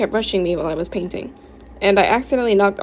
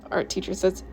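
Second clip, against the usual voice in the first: ordinary delivery, nothing marked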